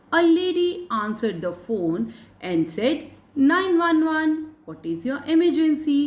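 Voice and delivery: put-on slow, deliberate reading to children; story read-aloud. Reads story aloud to pupils. (बच्चों के लिए धीमा कहानी वाचन)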